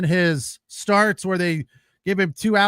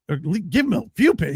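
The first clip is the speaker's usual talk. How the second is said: ordinary talk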